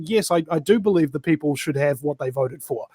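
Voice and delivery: ordinary talk, nothing marked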